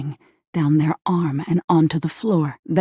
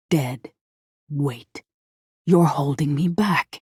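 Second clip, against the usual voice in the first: eerily perfect voice; slower than usual. A narrator's reading. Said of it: "they say".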